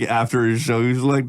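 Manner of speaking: ordinary talk, nothing marked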